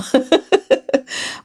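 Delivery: short, high-pitched giggle; laugh. Real, not acted. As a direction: giggle after your playful complaint. Own laugh after complaining to producers.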